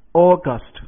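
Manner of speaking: August said loudly, stress on AU; pronunciation demo. Shown as a demonstration. Teacher introduces the next word August, loud. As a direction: announce August, first syllable stressed. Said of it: "重音节".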